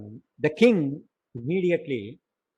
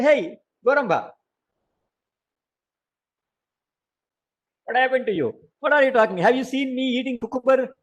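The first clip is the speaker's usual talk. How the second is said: angry scolding, higher and louder; storytelling voice for an enraged king; furious king; 5 semitones higher, 4 dB louder than usual. A narrator's reading. King threatens his servant over the mumbling, acted furious outburst. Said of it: "He got very furious".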